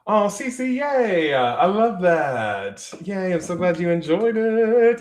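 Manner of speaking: high, drawn-out happy squeal; gushing delight. Real, not acted. Streamer thanking a viewer who enjoyed the book, gushing delight. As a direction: gush it out high and stretched, truly thrilled. Thrilled reply to viewer's praise.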